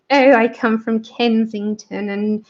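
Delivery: slow, put-on upper-class English accent; mock the posh Kensington accent. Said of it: "really, really posh".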